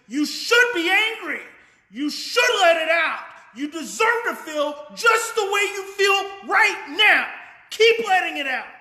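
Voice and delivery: loud and high, near shouting support; sounds like anger. Shown as a demonstration. Coach demonstrating matching to parents, loud angry-sounding encouragement. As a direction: pour anger into the tone, keep the words kind. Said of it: "tone that literally sounds like anger".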